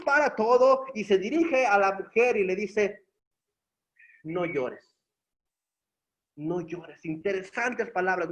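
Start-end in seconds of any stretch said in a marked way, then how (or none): 4.2-7.0 tierno. Acted. Jesús consuela a la viuda.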